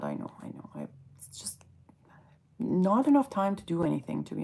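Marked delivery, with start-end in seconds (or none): 0.0-1.6 voiceless, much softer guilty aside; whispered apology to viewers; sheepish whisper. Real, not acted. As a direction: whisper the excuse under your breath.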